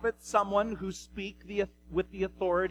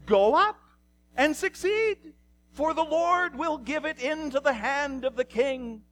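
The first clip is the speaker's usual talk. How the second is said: high, put-on mocking sing-out; exaggerated false enthusiasm, pitched way up; dripping sarcasm; 8 semitones higher, 3 dB louder than usual. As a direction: parrot the official answer in a much higher voice. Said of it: "with sarcasm".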